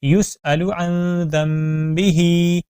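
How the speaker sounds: يُسْأَلُ with a clear glottal hamza, recited slowly; hamza sound. Shown as a demonstration. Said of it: "حمزہ کی sound کا آپ نے خاص خیال رکھنا ہے".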